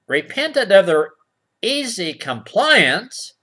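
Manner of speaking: usual speaking voice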